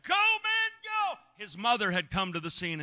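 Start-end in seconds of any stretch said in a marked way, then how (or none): 0.1-1.2 high cheering shout; hollering. Retold or imitated. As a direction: yell him on like a crowd. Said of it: "we're hollering".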